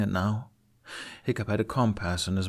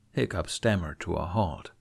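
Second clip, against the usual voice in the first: ordinary delivery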